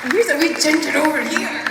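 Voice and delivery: delighted loud call. Real, not acted. Spotting a child in the crowd.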